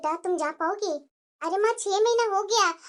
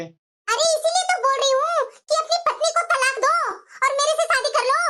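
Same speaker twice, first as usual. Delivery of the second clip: coaxing demand; 7 semitones higher than usual. Acted. Girlfriend demands he divorce wife.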